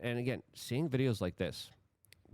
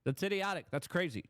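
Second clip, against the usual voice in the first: exasperated public; 9 semitones higher than usual. Retold or imitated. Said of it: "The vast majority of people are like".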